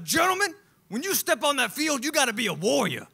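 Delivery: high, loud preacher-coach rally cry; booming sideline sermon; fiery motivational speaker. Retold or imitated. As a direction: whip the boys into a frenzy. Motivational speaker addressing young players.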